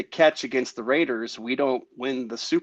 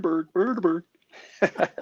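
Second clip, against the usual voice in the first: Swedish Chef impression; 4 semitones higher, slower than usual. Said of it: "doing a Swedish Chef impression".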